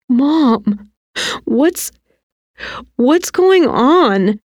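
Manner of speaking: high, halting plea; falter, then plead slowly for an answer; frightened confusion. A narrator's reading. Scared boy asks his mother.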